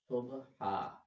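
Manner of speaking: सुबहा with ह pushed from the throat; सुबहा, throaty ह; throaty Subha. Shown as a demonstration. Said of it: "हा जो है वो हलक से अदा होगा". (हलक से ह वाला सुबहा)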